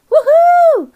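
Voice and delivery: loud, high cheer; big celebratory woohoo; excited woohoo. Real, not acted. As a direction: whoop with joy. Celebrating going live.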